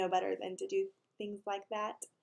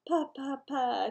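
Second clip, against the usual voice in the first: put-on baby babble; 4 semitones higher, slower than usual. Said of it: "Tootsie said".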